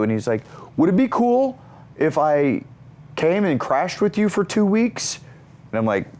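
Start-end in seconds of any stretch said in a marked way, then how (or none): 0.8-5.2 high-pitched imitated favor-asking; Zac Efron. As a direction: ask hopefully as the friend, pitched up. Zac asks to crash.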